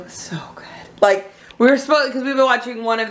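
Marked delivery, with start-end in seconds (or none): 0.0-0.6 playful confiding whisper; secretive whisper. Pretend. Vlogger hiding her opinion from her husband, mock-secret whisper. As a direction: drop to a whisper as if sharing a secret.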